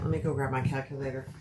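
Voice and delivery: usual speaking voice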